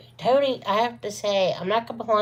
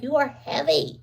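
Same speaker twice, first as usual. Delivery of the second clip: teasing complaint; 7 semitones higher, 3 dB quieter than usual. Retold or imitated. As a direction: moan it at him playfully. Teasing husband about weight.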